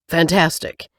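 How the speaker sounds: plain reading voice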